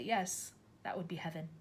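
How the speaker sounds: gentle soft teacher; soft and tender. A narrator's reading. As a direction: say it wistfully. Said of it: "Miss Honey said softly".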